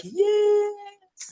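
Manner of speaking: long, high drawn-out yes; a sung-out celebratory yes; triumphant cheer. Pretend.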